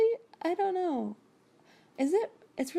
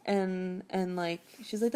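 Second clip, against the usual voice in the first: normal talking voice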